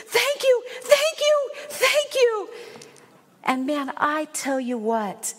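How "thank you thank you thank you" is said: very high, gushing imitation; overjoyed thanks, imitated. Retold grateful woman.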